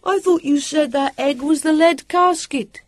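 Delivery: high, slow calling complaint; sulky high-pitched shout; petulant. A narrator's reading. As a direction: call it over, put out and disbelieving. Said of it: "Sam said petulantly".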